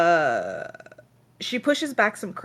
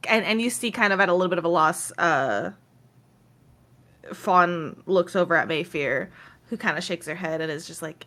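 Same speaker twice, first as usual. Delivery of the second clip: normal talking voice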